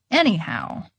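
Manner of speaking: ordinary delivery, nothing marked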